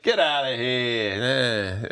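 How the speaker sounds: slow, hammed-up Jersey accent; terrible Jersey accent. Movie recapper mimics the lead brushing off a question, bad Jersey accent. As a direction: imitate a Jersey guy, badly and drawn out. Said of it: "the way you're doing the accent".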